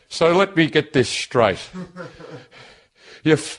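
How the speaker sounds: breathless mum impression; imitating mum out of breath. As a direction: puff through it like mum on the phone. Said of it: "He's out of breath".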